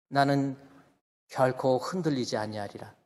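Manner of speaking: normal talking voice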